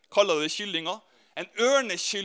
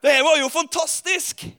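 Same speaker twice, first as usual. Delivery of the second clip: thrilled; 4 semitones higher, 4 dB louder than usual. Retold or imitated. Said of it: "og han bare".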